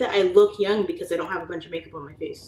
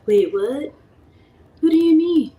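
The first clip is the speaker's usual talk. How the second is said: high, loud, baffled put-on voice; a new realtor baffled by jargon, high and loud; confused surprise; 10 semitones higher, 9 dB louder than usual. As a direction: sound totally lost.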